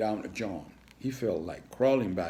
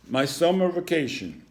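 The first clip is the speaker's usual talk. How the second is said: reading aloud; 9 semitones higher, 7 dB louder than usual. A narrator's reading.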